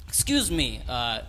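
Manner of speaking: loud put-on Russian accent; Russian terrorist accent. Terrorist calls the bartender in mock Russian accent. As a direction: do a rough attempt at a Russian accent. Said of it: "this is a Russian terrorist".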